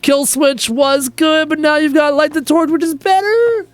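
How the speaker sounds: high, slow, strained drawn-out words; agonised indecision. Pretend. Streamer struggles to pick one favourite band, comically agonised and high. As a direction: squeeze each name out, climbing in pitch.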